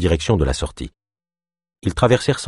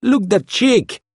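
very high, slow yelp; yelping thug; 13 semitones higher, much slower than usual. A narrator's reading. Thug points Ava out to his gang, leering yelp. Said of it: "glapit le métis".

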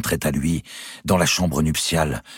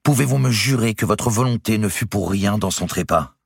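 firm, insistent, higher; determined; 8 semitones higher, 3 dB louder than usual. A narrator's reading. Clémence presses Louis on his wife's death, determined. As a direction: hold him to it. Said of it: "avec détermination".

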